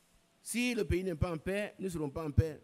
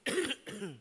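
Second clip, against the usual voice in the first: short dry cough; a real dry cough; 4 semitones higher than usual. Real, not acted. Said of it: "je tousse un peu".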